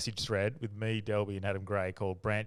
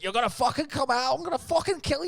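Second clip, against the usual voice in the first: high, loud mock taunting; high-pitched mock ribbing shout; imitating Kyle; 4 dB louder than usual. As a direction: taunt your mate to come out drinking, hammed up. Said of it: "I won't do the accent".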